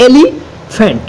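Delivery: Elephant broken as এলি, pause, ফ্যান্ট; split in two. Shown as a demonstration. Teacher dividing a word for students, elephant in two parts. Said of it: "যে এটাকে ভেঙে ভাগ করি".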